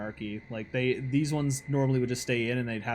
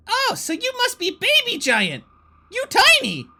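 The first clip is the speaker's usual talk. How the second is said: very high, loud excited goblin squeal; 8 dB louder than usual. Acted. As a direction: shriek it out gleefully like a small goblin. GM voices goblin.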